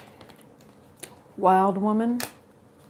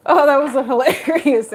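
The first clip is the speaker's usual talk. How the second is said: laughing; 6 semitones higher, 4 dB louder than usual. Real, not acted. Still laughing.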